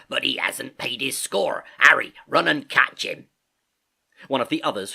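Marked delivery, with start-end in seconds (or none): 0.1-3.3 sailor's order building to a shout; Silver calling out. A narrator's reading. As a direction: end loud, sending a man after him. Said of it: "cried Silver".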